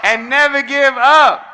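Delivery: loud and high, a line for the crowd to repeat; rousing call. Shown as a demonstration. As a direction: call it out so the whole room can shout it back. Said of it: "Tell them".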